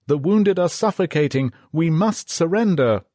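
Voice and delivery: high, loud call to the captain; yelled plea. A narrator's reading. Lieutenant pleads with the captain, loud high yell. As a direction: shout to get the captain's attention. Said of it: "yelled the lieutenant".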